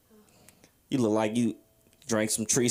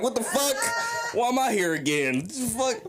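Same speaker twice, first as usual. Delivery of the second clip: mock dismay; 8 semitones higher than usual. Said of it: "I'm like".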